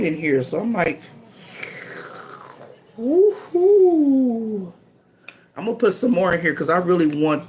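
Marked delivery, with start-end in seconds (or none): 3.0-4.7 cold shiver woohoo. Real, not acted. Cold in here, I'm like.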